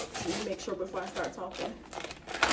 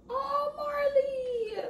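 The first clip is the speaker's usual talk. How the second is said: high, very slow drawn-out cry; emotional exclamation; much slower than usual. Real, not acted. Reader reacting to the book, high emotional exclamation. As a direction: stretch it out high, deeply moved.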